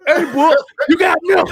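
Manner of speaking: high, loud, laughing shout; mock shouted taunt. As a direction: bark it at the street like a goofy tough guy. Quoting wrestler in street skit.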